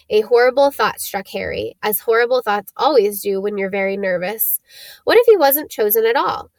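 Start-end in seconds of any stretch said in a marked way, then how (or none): none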